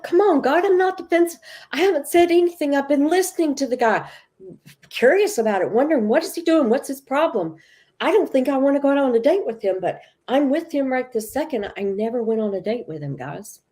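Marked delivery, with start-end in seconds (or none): 0.0-11.7 indignant protest. Retold or imitated. Woman insisting to God she isn't defensive, high and heated. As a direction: argue her innocence, voice shooting up.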